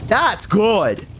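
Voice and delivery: high-pitched, forced "mm" of approval; poorly hide your distaste behind praise; feigned liking. Retold or imitated. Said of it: "I'm just not liking it".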